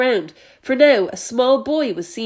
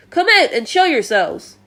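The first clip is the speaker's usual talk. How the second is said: loud, higher commanding call; shouting order; 5 semitones higher, 4 dB louder than usual. A narrator's reading. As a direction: bark it up to the peach. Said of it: "shouted the chief of police".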